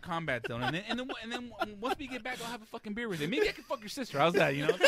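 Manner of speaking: rising, loud eager rambling; excited kid rambling. Retold or imitated. Reservist doc rambling about combat.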